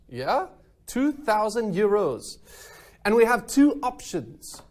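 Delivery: high, hammed-up French accent. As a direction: play the over-the-top French host. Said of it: "I'm gonna stop speaking in my French accent now".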